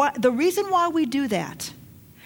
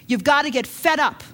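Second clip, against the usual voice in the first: normal talking voice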